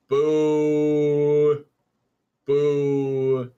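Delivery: long, level, drawn-out boo; jeering, held on one steady note. Real, not acted. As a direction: heckle like a displeased crowd. Booing the map decision.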